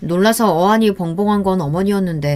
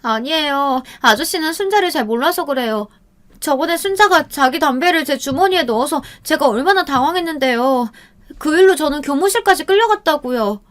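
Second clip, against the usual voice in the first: put-on high, girlish, falsely innocent voice; a sly girl framing her friend with fake embarrassment; feigned fluster; 8 semitones higher than usual. A narrator's reading. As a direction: play the innocent victim. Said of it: "당황한 척하며".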